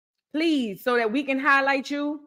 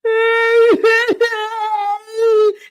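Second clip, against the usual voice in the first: high, loud laugh close to tears; loud tearful laugh; 12 semitones higher, 8 dB louder than usual. Real, not acted. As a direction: laugh out loud, almost crying. Overwhelmed by gift dolls.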